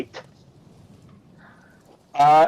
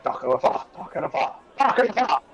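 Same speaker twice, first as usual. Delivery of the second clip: varied murmured greetings from passersby; mutter, whisper, then call it out; townsfolk greeting; 3 semitones higher, 3 dB quieter than usual. Acted. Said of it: "a couple people are like".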